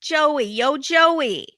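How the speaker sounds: high Italian-American buddy calling out; hammed-up Italian-American greeting. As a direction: holler like a mock Italian-American pal. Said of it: "it couldn't be more stereotypical".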